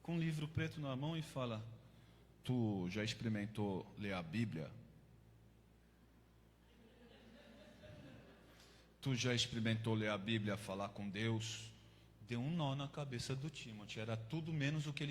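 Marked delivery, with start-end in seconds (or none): 2.4-11.7 a big tattooed cellmate asks about the Bible in a much lower voice; deep-voiced cellmate. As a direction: drop deep like a hardened convict. Tough cellmate offers the Bible.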